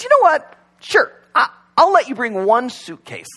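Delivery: high, bright mock God voice, rising on you know what. God in the joke granting the rich man's wish, lifted high. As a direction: play God giving in with a grand flourish.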